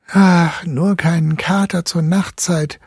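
put-on high, begging girl's voice; pleading girl. A narrator's reading. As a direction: plead with the family. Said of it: "bat Luise".

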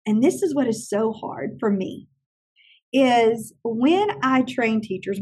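ordinary talk, nothing marked

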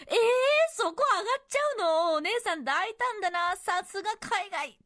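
high-pitched, drawn-out amazement; astonished. Acted. Letter writer reacting to the staffer climbing the counter, astonished. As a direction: marvel aloud with theatrical surprise. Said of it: "と驚きました".

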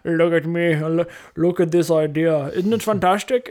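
mock-proud, stretched-out bragging voice; self-congratulating. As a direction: show off your brilliant idea, hamming it up. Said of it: "we give ourselves a badge of honor and be like".

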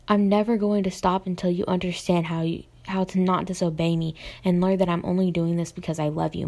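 ordinary delivery

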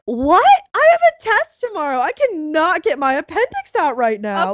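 high, frantic protest about the test; panicked disbelief. Retold or imitated. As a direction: squeak out your panic like a stressed student. Panicked reply to appendicitis news.

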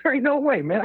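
can't believe it, let it leap up high; incredulous. Real, not acted. Rejects the election numbers.

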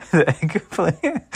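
real high-pitched laughing fit; words stretch out into helpless laughter; cracking up. Real, not acted. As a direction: crack yourself up mid-phrase. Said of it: "I make myself laugh".

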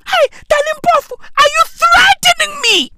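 fast, high, hammed-up accusing shout; mock shouted question. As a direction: shriek the question in mock alarm. Reenacting the confrontation, shouted.

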